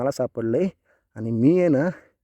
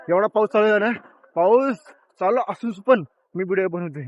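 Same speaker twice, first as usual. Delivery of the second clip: raised over rain; 7 semitones higher, 8 dB louder than usual. Real, not acted. Talking over heavy rain.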